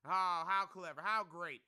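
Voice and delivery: sarcastic. Real, not acted. Sarcastic praise of rival traders.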